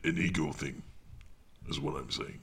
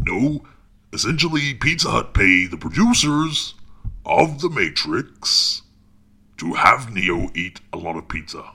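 raised voice, drawing out the last phrase; insistent explaining; 3 semitones higher, 8 dB louder than usual. Acted. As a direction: spell it out forcefully for someone not getting it. Insistently corrects the technician's misunderstanding.

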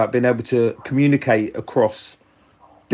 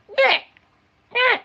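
tiny high kitten squeak; short, squeaky imitated mew. Imitating the rescued kitten, short high squeaks. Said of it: "it just squeaked all the time".

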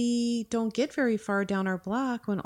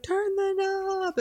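much higher, slow, drawn-out call; urgent call; 13 semitones higher, slower than usual. Retold or imitated. Mother retelling calling to her daughter, high urgent call. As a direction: call it across the yard, urging. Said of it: "And I'm like".

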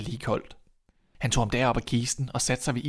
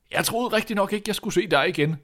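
raised-pitch, astonished king; amazed king; 8 semitones higher, 3 dB louder than usual. Acted. King greeting the boy he thought lost, amazed. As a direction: marvel at seeing him alive.

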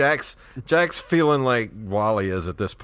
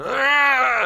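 shrill mock scream, loud and high; loud high yelp; 7 dB louder than usual. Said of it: "when he's going".

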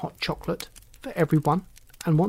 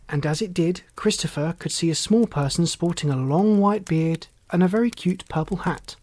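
ordinary delivery

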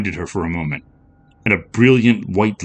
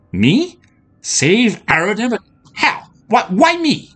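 rising, disbelieving high questions; astonished; 13 semitones higher than usual. Acted. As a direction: voice climbing in disbelief. Andrea stunned by the prophecy.

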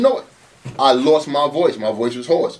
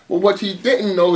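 normal talking voice

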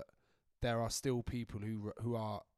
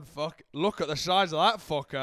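louder, mock-scared referee; 4 semitones higher, 9 dB louder than usual. Imagined referee in awe of Tony Adams. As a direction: play a ref stunned by a big defender. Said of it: "Ref's gone".

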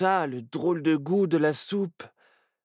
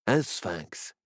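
usual speaking voice

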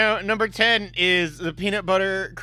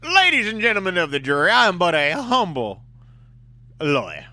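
grand courtroom speech, louder; passionate closing argument; 4 semitones higher, 7 dB louder than usual. Pretend. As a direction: address the jury like a showman lawyer. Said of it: "sassy Southern lawyer".

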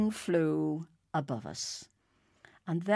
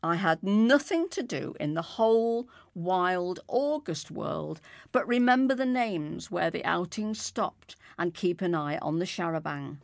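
usual speaking voice

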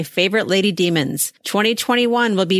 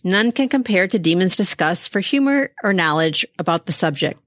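ordinary talk, nothing marked

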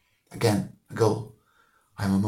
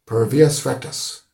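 slow, loud, firm declaration; 3 semitones higher, 8 dB louder, slower than usual. A narrator's reading. Deasy quotes his ancestor's motto to Stephen, firmly. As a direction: pronounce the motto firmly and deliberately. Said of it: "Mister Deasy said it firmly".

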